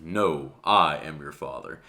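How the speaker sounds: correct Vader line. Retold or imitated. YouTuber giving the real movie line, low Vader imitation. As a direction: drop it low and slow like Vader. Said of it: "where Vader said".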